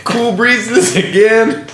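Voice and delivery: high, slow, drawn-out mock disdain; mocking unimpressed woman. Woman scoffing at a man's terrible pickup line, high and slow. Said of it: "this woman talking to a terrible drink".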